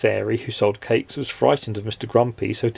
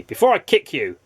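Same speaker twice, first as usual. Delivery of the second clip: higher, threatening snap; snapping; 6 semitones higher than usual. Acted. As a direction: threaten him crossly. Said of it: "snapped Mister Grumpy".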